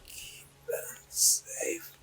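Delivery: high, slow, breathless whisper; dry parched whisper. Acted. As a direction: rasp it out like a dying spirit. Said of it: "this very dry, parched voice".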